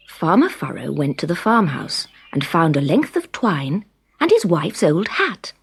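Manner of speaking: ordinary delivery, nothing marked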